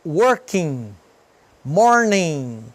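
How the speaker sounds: slow "Working, morning" ending in a nasal ng; silent final G. Shown as a demonstration. Teacher modelling for learners, silent G in "Working, morning". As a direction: let "Working" and "morning" end with no hard g. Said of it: "a gente não pronuncia o G".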